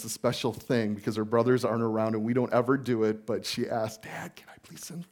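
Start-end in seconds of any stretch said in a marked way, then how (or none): 4.0-5.0 a daughter's hushed request; whispered child plea. Retold or imitated. Pastor imitates his daughter asking for the front seat, whispered. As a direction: whisper "Dad, can I please sit?" a little higher.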